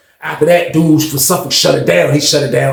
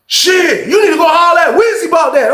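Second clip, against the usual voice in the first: shouted deflection; 13 semitones higher than usual. Retold or imitated. Passing the blame.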